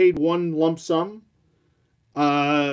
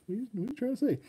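voicing the dog; 8 semitones higher, 9 dB quieter, much faster than usual. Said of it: "It's like".

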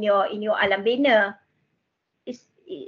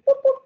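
playful sound effect. Retold or imitated. Imitated camera or horn sound.

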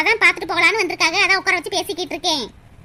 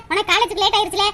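normal talking voice

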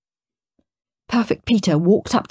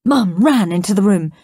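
plain reading voice

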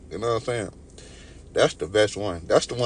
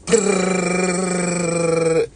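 loud high shivery brrr from the cold; shivering brrr; 9 semitones higher, 6 dB louder than usual. Real, not acted. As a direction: shiver out loud like you're freezing. It's cold.

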